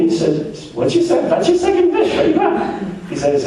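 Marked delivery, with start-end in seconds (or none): 0.7-3.1 very high, chirpy genie voice with a laugh; squeaky genie. Acted. Genie asks for second wish.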